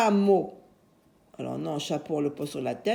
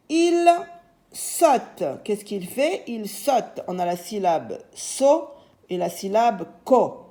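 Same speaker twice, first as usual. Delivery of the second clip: ordinary talk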